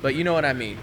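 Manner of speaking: ordinary talk, nothing marked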